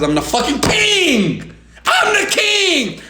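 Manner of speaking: high, drawn-out triumphant shout; royal proclamation. Acted. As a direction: proclaim it with the key word stretched out high. Proclaims himself king, motivational rant.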